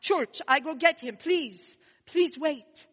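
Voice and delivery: a hint of a stammering, astonished father; lightly voiced broken-English pleading; stuttering father. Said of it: "Then he stuttered".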